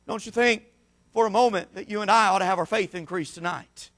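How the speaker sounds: usual speaking voice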